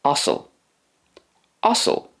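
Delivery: teacher models "osel"; Irish word modelled. Shown as a demonstration. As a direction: repeat "osel" clearly. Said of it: "The Irish for a donkey is".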